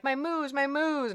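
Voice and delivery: imitating a small niece, high-pitched voice; excited little niece. Niece looking through at the cows, imitated in a high child voice. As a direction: mimic a child calling out in a high voice. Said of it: "she would always ... and be like".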